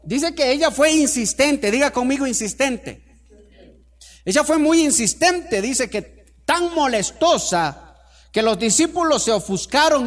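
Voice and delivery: loud, high preaching at full force; fervent preaching. Real, not acted. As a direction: drive the story home to the congregation. (predicación ferviente y a todo volumen)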